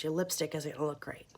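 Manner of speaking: ordinary talk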